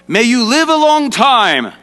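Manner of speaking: high, loud voice of a king; kingly proclamation. Acted. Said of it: "like a king would say 'em".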